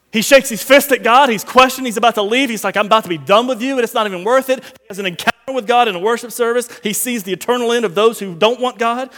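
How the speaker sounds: rising preacher's fervor; preaching at full force. Acted. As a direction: preach it hard to the church.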